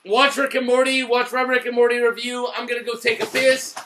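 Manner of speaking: normal talking voice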